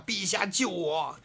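high, drawn-out plea; crying out for help. A narrator's reading. Dragon King begs the emperor in a dream, crying out. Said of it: "嘴里就叫着啊".

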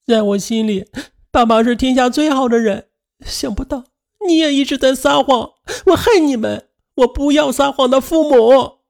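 put-on high hurt child voice; questioning. A narrator's reading. Daughter confronting her tearful father, hurt child voice. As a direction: accuse the parents like a wounded child. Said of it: "便质问道".

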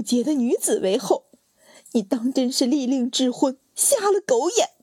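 high, cracking crying-laugh scorn; hysterical tirade. Acted. Wife raging at her foolish sister, high and crying. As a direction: rail at her until your voice breaks. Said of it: "放声大哭， 笑得声嘶力竭".

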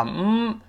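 嗯 as a humming m sound; closed-lip m. Shown as a demonstration. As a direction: hum 嗯 with the mouth shut. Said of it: "最后M结尾发嗯". (闭住嘴发嗯)

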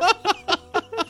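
high laugh. Real, not acted. Laugh after joke.

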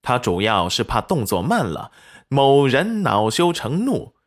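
ordinary delivery

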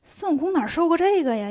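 ordinary delivery, nothing marked

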